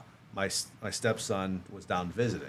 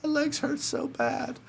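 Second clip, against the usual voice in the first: high, slow pretend whimper; imitating crying in pain; mock crying; slower than usual. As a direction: whine it out like a hammed-up sob. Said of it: "laying in bed at night crying".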